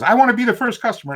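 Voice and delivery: high, quick imitation of an eager woman; eager lady customer. Customer at the store asks to be first, high eager mock voice. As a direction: pipe up like a keen shopper.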